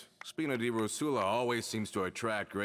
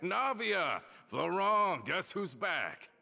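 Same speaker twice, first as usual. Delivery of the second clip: excited call; 11 semitones higher, much slower than usual. Acted. Returning prisoner calls out to his old friends, thrilled. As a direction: hail old friends across the room.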